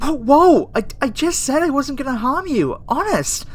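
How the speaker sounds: high, loud, alarmed protest; yelp, then insist in a panic; startled pleading. Acted. Scientist swearing not to harm the human, startled pleading.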